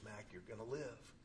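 a hint of a gentle father, hushed; calm dad voice. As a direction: lean over a winded kid and calm him down. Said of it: "and he goes".

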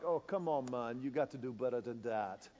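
relaxed island guide telling the pastor to loosen up; lightly voiced fishing guide. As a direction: coax the learner easily, with a chuckle. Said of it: "He was like".

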